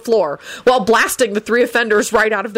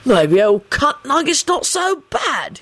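slow, higher-voiced musing; slow self-directed remark; musing to himself; 8 semitones higher, slower than usual. A narrator's reading. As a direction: think aloud, taking your time. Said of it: "he mused to himself".

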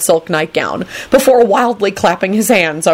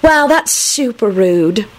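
put-on girl voice; 4 semitones higher, much slower than usual. Rosie girl voice.

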